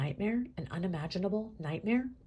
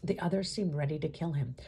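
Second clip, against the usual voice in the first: ordinary delivery, nothing marked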